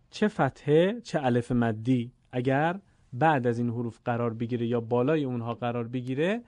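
usual speaking voice

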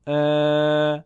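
ا held long and level; long alef. Shown as a demonstration. Teacher contrasting alef maddi with fatha, prolonged. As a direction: stretch ا to double length. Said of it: "الف مدی با اندکی کشش".

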